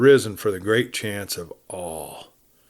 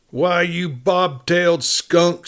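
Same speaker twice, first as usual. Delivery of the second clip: slow, higher mock shout; shouting insult; 7 semitones higher, slower than usual. A narrator's reading. New voice yelling at the sheriff, hammed-up shout. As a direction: hurl the insult like a rowdy cowhand. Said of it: "shouted a new voice".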